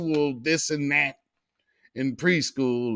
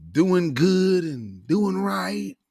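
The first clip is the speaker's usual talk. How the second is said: usual speaking voice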